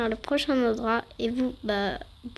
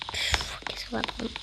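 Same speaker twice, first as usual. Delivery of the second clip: whispered to self; 7 dB quieter than usual. Real, not acted. Muttering while choosing vehicle.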